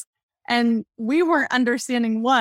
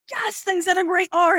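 excited praise; 8 semitones higher than usual. Retold or imitated. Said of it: "And we're like".

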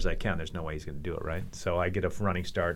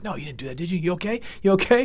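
higher, anxious checking, mildly acted; retold freak-out; 8 semitones higher, faster than usual. Rival freaking out over the stapled leg, imitated worry. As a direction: imitate a rattled friend checking on you. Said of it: "starts freaking out".

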